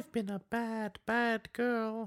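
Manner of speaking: a hammed-up imitation of a British girl; mock British little girl. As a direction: play the creepy hologram child quoting her line. Joins the hologram girl impression.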